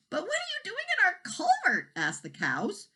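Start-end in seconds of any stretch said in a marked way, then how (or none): 0.1-1.9 much higher cow's voice. A narrator's reading. Cows waking the baby dragon, high voice. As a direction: pipe it like a curious cow.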